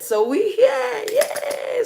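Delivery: very high, loud excited squeal. Real, not acted. Host greeting her live viewers, squealing with excitement. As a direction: squeal it out like you can't contain the joy.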